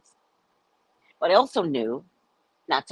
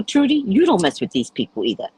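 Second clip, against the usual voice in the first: ordinary talk, nothing marked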